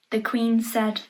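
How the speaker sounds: ordinary delivery, nothing marked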